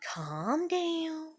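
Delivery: soft, hushed calm down; a grandmother quietly calming a frightened child, gently; soothing grandmother. Retold or imitated. Grandmother calming the child, soft and hushed. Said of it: "her just being like".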